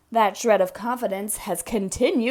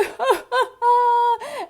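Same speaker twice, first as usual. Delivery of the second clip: mocking laughter; 13 semitones higher, 4 dB louder than usual. A narrator's reading. Everybody laughed at me.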